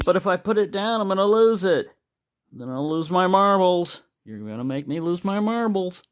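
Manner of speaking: hammed-up protest; comic high pleading. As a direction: whine it like a cartoon character. Comic argument with police.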